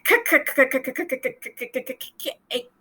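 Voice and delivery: repeated clicking K sounds, fading away; "k-, k-, k-" in rapid bursts trailing to a bare click; stuttered consonant. Shown as a demonstration. Teacher failing to stretch the C in cake, sputtered K sounds. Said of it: "stretch out the C in cake".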